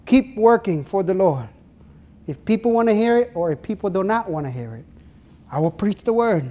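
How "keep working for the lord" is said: ordinary delivery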